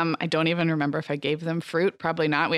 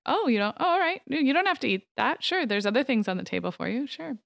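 playing dumb; 7 semitones higher than usual. Mom playing dumb at dinner.

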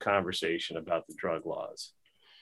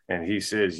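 normal talking voice

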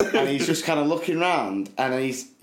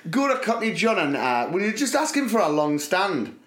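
higher-pitched mock Geordie PE teacher; 6 semitones higher than usual. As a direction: imitate a Geordie teacher sending a pupil off. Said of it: "Geordie P E teacher said".

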